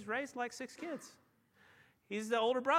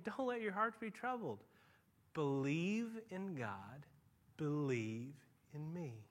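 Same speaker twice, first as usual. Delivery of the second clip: tender Jesus; 4 semitones lower, 4 dB quieter, slower than usual. Acted. Jesus comforting disciples.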